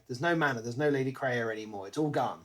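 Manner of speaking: normal talking voice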